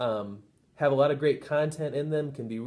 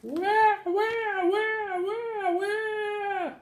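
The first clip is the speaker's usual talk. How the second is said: very high wah-wah wail, fading; high infant crying that tails off; crying baby; much slower than usual. Acted. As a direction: bawl right at the teacher like a baby. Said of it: "The baby looked at the teacher and said".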